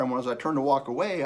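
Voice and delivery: ordinary talk, nothing marked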